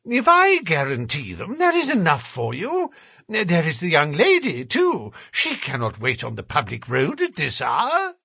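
high-pitched, perplexed appeal; helpless gentleman pleading. Acted. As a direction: plead fussily, voice lifting high. Voices Thaddeus pleading with porter.

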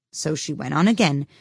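usual speaking voice

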